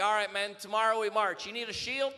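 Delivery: loud, high rallying cry to the troops; booming rousing general speech. Acted. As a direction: bark orders like a general before battle.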